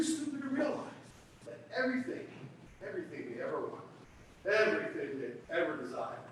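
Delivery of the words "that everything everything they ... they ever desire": low, hushed build-up to the reveal; hushed dramatic. Acted.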